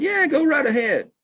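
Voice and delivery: hammed-up country woman egging him on; lady's sly permission. Lady lets the naturalist dig up the root, high sly voice. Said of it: "She said".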